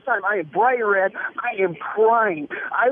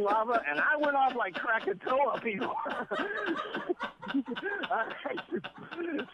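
much softer, dissolving into laughter; laughing, fading away; 3 semitones higher, 11 dB quieter, slower than usual. Real, not acted. Teller cracking up describing the bathroom, quiet laugh. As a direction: laugh it out and trail off.

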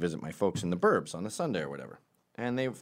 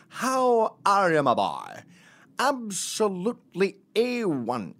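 loud, drawn-out, high fancy Scottish greeting; affected Scottish accent; 12 semitones higher, 6 dB louder, slower than usual. Acted. Dad greeting his boy in an affected fancy Scottish accent. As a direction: put on a grand Sean Connery-style Scottish accent. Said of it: "that accent that I do where he's like".

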